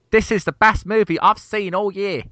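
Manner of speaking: high, excited fanboy voice; gushing fan quote. Retold or imitated. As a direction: gush like a fanboy. Quoting a gushing review.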